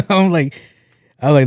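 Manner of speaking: normal talking voice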